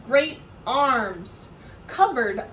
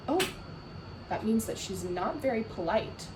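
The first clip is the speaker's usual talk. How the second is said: usual speaking voice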